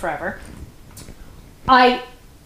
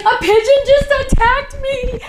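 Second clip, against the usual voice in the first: much higher, louder, slowed panicked cry; freaking out; 14 semitones higher, 6 dB louder than usual. Retold or imitated. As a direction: imitate a friend in a pigeon panic. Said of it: "she was freaking out".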